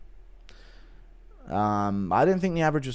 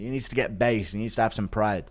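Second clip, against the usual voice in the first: ordinary delivery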